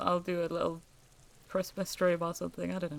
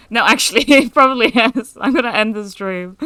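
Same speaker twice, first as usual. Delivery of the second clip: laughing loudly; 3 semitones higher, 15 dB louder than usual. Real, not acted. Cracking up at chat.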